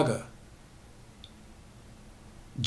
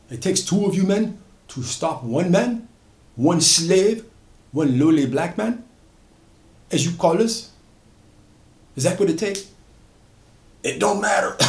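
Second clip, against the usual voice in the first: higher, defiant taunt; defiant anger; 6 semitones higher, 4 dB louder than usual. Acted. Solomon backing away from two catchers, defiant and raised. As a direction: throw their numbers back at them.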